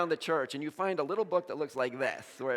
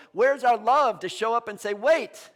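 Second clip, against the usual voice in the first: loud, raised-pitch preaching; impassioned challenge; 6 semitones higher, 10 dB louder than usual. Real, not acted. Preacher urging congregation to stand against injustice, loud and impassioned. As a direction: build to a forceful halt on the last word.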